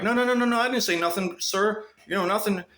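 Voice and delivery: high, pleading mock-coward voice; scared backing down. Stalker backing down, imitated as a high scared coward. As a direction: whimper out a frightened denial. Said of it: "you go like".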